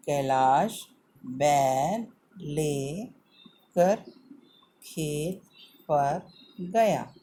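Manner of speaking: "कैलाश बैल लेकर खेत पर गया" read very slowly in pieces, each word stretched; slow and broken up. Shown as a demonstration. Teacher to children, reading the sentence in slow pieces. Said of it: "हमें ध्यान रखना है".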